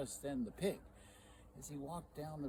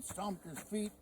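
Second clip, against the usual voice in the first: ordinary delivery, nothing marked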